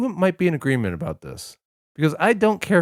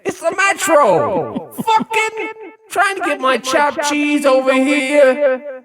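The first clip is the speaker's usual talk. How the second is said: put-on Brooklyn accent; 11 semitones higher, 5 dB louder than usual. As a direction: yell it like a subway New Yorker. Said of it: "Brooklyn guy on".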